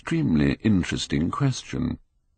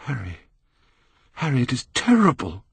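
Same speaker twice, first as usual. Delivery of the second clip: higher anguished cry; 9 semitones higher than usual. Acted. Dorian cries to Harry about the death, anguished and higher. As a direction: cry out in distress. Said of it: "cried the lad".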